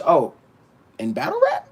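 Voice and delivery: usual speaking voice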